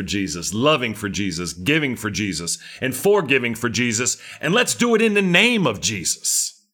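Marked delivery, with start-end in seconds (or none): 4.4-6.7 high fervent preacher call; rising fervor. Real, not acted. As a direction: lift the room to its feet. Climax of rousing sermon.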